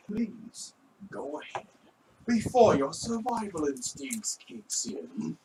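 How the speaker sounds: soft, slow creepy butler voice; menacing politeness, almost whispered; Skeeves the Butler. Acted. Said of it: "pretty good Skeeves the Butler impression".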